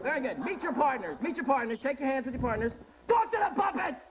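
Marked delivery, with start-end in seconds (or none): 3.1-4.0 high, yelled host order; mock command. Host orders contestants to address puppets, high mock yell. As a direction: bark it out as a sudden comic order.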